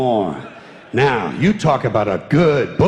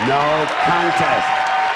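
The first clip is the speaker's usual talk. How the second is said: slow emphatic repeat; much slower than usual. Acted. Repeated punchline, slowed down.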